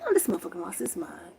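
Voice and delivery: fast unvoiced whisper. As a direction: hiss it under your breath. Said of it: "I said".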